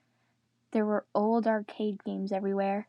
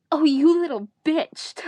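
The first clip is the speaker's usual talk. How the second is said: loud, slow furious line, a bit higher; angry snarl; 6 semitones higher, 9 dB louder than usual. Acted. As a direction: spit it at her, hammed-up rage.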